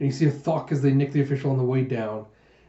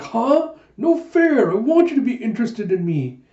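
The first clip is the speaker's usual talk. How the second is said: high, loud mock-petulant protest; offended; 9 semitones higher, 5 dB louder than usual. Jeha told nobody is interested in him, offended whine. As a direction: protest like a slighted child, starting very high. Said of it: "Jeha's all offended".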